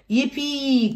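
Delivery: high, loud yippee; cheering. A narrator's reading. Children thrilled about the outing, cheering. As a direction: shout with glee. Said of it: "cried Pepper and George".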